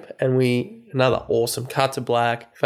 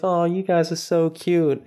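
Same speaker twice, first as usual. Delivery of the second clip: lightly imitating a drunk teacher, pitched up; higher, mock-tipsy cooing; tipsy gushing teacher; 5 semitones higher than usual. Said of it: "like, laughing and she's like".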